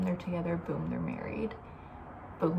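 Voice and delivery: ordinary delivery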